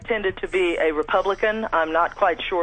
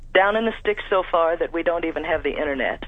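usual speaking voice

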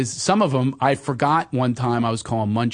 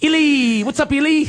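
much higher, louder sing-song greeting; 12 semitones higher, 4 dB louder than usual. Shown as a demonstration. Greeting his female friend, pitched much higher. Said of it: "I go a little higher".

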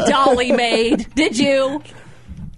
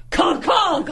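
high yelped bonk sounds, much higher; mock knocking noise; 8 semitones higher than usual. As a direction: yell out the sound of the chin hitting. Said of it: "and I go".

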